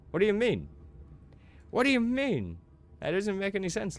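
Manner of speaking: very high, loud squawk of disbelief; hammed-up exasperation; mock incredulous outrage. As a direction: let the pitch soar in protest. Playful protest at friend's illogical reply.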